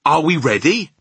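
high, loud, eager asking; loud question. A narrator's reading. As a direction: call it out at full voice. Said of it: "you is shouting away right inside my ear".

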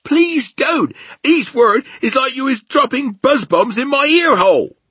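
high, very loud pained giant; pleading cry. A narrator's reading. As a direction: beg at the top of the voice. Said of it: "cried the poor B F G".

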